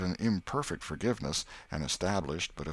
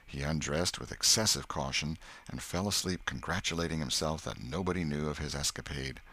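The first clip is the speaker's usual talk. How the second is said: ordinary delivery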